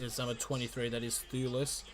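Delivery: normal talking voice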